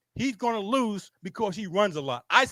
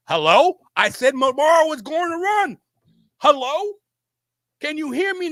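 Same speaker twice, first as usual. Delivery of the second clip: exasperated loud insistence; 6 semitones higher, 5 dB louder than usual. Real, not acted.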